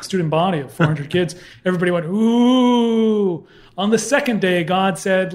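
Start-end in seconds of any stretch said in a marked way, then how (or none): 2.1-3.5 high, loud mock crowd ooh; imitated crowd gasp. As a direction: voice the whole crowd going ooh. Crowd reaction in story.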